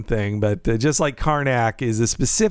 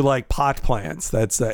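ordinary talk

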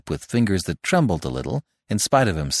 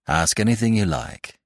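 plain reading voice

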